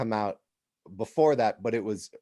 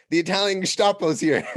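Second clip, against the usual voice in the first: laughing mock alarm; 9 semitones higher, 7 dB louder than usual. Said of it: "I was like".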